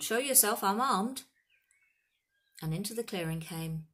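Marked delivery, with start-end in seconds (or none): 0.0-1.2 loud, raised-pitch challenge; called warning. A narrator's reading. Hagrid warns an unseen intruder, loud call. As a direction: call out a threat to a hidden stranger. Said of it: "Hagrid called".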